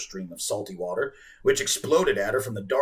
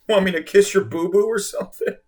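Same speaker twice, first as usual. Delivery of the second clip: mock-sweet teasing, higher and louder; chiding; 7 semitones higher, 6 dB louder than usual. A narrator's reading. Girl mocking the whale, chiding baby talk. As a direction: tease him like a baby. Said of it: "she added chidingly".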